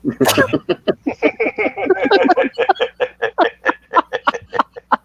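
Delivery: long, high, real laughing fit. Real, not acted. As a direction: crack up helplessly at the story. Listener laughs at the impression.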